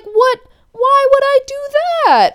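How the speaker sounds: very high, loud protest; exaggerated high-pitched disbelief; mock incredulity. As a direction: imitate a boyfriend squealing his innocence. He acts incredulous when accused.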